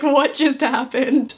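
slow, laughing disbelief; dragged-out giggly what just happened; stunned laugh. Retold or imitated. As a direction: let the laugh spill through the stunned question. Friends stunned after surprise kiss.

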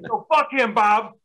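a hint of an angry old Italian guy; mock the old guy's stubborn refusal. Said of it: "And he's like".

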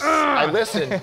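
repeated through a laugh. Pretend. Co-host teasing him, he laughs as he insists. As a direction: crack up mid-protest.

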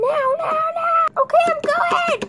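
high, loud frantic shrieks; panicked shouting. Acted. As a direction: shriek urgent orders in mock terror. Acted panic during attack.

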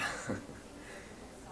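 soft self-conscious giggle; nervous laugh. Acted. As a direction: laugh off the compliment. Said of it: "I'm so bad at this".

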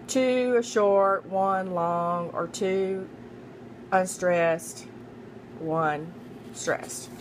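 measured chunks with pauses between; "two unstressed, one stressed" split by pauses, falling away; deliberate recap. Shown as a demonstration. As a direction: lay out "two short, one long" piece by piece. Teacher spells out the two patterns.

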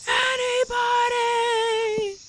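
high, long drawn-out call into the distance; lonely mock call, very slow. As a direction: stretch it out like calling across a valley. Calling out alone in game.

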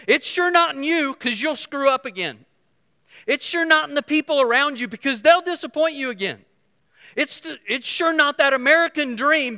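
loud, high sermon climax; impassioned preaching. Acted. Preacher proclaiming hope to the congregation, loud and high. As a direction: hammer each line home.

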